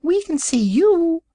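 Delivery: high, slow squeaky little piglet. A narrator's reading. Piglet telling the hidden wizard it can see him, high and slow. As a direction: pipe it up like a tiny pig. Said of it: "said another piglet".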